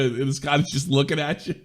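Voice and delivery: usual speaking voice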